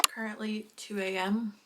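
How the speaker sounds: ordinary talk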